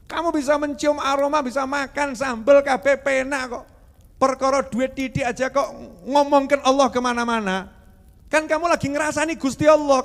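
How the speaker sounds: high, loud indignant preaching; forceful, high-pitched reproach; loud scolding. Acted. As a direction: tell them off at full voice. Scolding those who complain about God.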